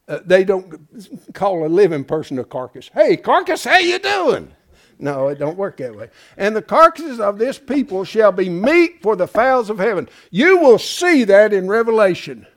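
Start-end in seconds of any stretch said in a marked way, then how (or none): 2.9-4.5 much higher, chirpy mock hello; mock cheery greeting. 6.4-10.1 loud, solemn reading of the verse; scripture reading. Acted. Reads scripture aloud.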